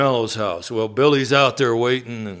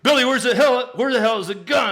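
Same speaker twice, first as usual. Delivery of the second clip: frantic demand; faster than usual. Retold or imitated. Brother demanding gun, high.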